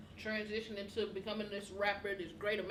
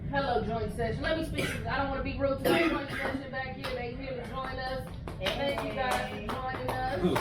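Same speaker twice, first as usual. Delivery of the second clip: calling out; 5 dB louder than usual. Real, not acted. Greeting people in the back.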